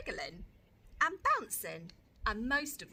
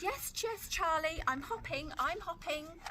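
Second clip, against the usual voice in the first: eager; 7 semitones higher than usual. Acted. Lola eagerly agreeing.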